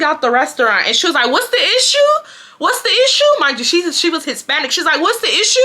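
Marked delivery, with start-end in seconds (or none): 1.3-3.2 high-pitched imitation of an indignant woman; mock confrontation, pitched way up; confronting lady. 5.0-5.6 high mock challenge; confronting lady. Retold lady confronting the woman again.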